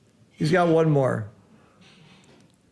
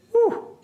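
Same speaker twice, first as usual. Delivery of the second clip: relieved whew. Real, not acted. Relief after a lucky roll.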